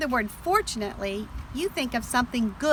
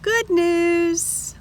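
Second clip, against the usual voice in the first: bright cheerful good news, pitched up; happy; 7 semitones higher than usual. Shown as a demonstration. Teacher reading to children, delighted. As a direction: cheer it like a gift.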